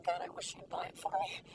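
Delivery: hushed, under her breath; secret wish. Retold or imitated. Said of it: "secretly going".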